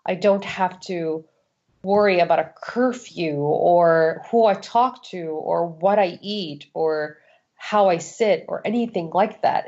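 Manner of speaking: ordinary talk, nothing marked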